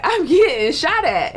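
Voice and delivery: slow, high, giggling through it; laughing retelling. Retold or imitated. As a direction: laugh at the absurd danger. Telling friends on the phone.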